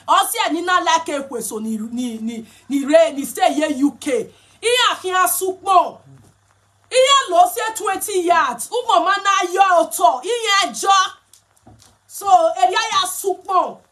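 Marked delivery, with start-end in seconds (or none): 4.6-13.8 raised, higher scolding voice, slowed right down; hammed-up telling-off; mock scolding. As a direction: scold like an annoyed elder over the cloth. Acted scolding continues.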